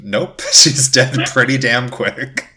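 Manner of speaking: normal talking voice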